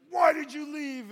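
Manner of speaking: high, slow, furious bellow; acted angry gang president roar; confronting shout. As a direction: yell it right in his face. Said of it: "he gets right up in my face".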